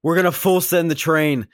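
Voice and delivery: high, loud mock-heroic declaration; mock gung-ho. Film fan mocking the hero's plan for the train, mock gung-ho. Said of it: "no, he's like".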